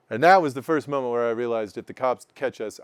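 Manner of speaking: ordinary delivery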